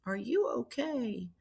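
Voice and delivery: quiet, lightly gravelled impression of a concerned woman; gravelly voice. Clergywoman checking on a staring young woman, lightly imitated gravelly voice. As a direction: softly imitate a gravelly-voiced older mentor, slowing down. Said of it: "this kind of gravelly voice".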